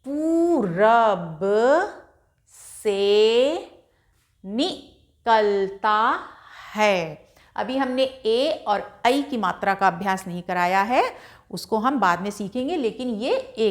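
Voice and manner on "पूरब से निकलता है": put-on each word drawn out slowly for a child; slow syllable-by-syllable reading; slow reading aloud. Shown as a demonstration. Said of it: "हमारा क्या हो गया वाक्य?".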